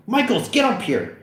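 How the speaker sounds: high urgent summons; calling out. Acted. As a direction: call your partner upstairs. Officer calls partner upstairs.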